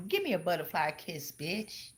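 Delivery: low, soft mock-sultry come-on; teasing bedroom voice; mock seductive. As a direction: purr it like a joke seduction. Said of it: "That sound kinda erotic".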